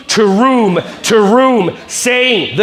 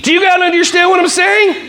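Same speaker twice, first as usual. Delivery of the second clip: exasperated shout; 9 semitones higher than usual. Acted. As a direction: blow up at them. Mock exasperated outburst.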